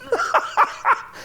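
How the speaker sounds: high cackle of derision; mocking laughter. Bully laughs at humiliated Les, high mocking cackle. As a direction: burst of jeering laughter.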